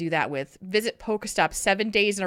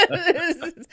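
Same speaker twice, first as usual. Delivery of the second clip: high laughing burst; 10 semitones higher, 11 dB louder than usual. Real, not acted. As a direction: laugh through the words. Laughing at own remark.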